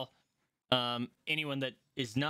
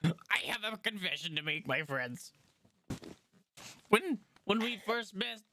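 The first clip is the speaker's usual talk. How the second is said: breaking down; 8 semitones higher than usual. Acted. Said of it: "he kinda breaks down a bit".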